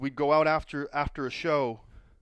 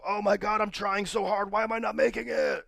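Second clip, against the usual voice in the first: higher, mock self-pitying whine building to a wail; whiny complaining; 8 semitones higher than usual. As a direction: whine like a wrestler sulking that nobody signs him. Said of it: "it sure does sound good".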